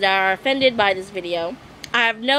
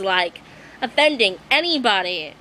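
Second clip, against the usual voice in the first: normal talking voice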